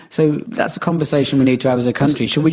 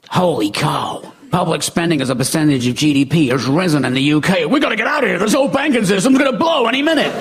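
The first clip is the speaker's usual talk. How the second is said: a hammed-up trader sounding the alarm; mock panicking banker; 4 semitones higher than usual. Imagined Wall Street banker panicking.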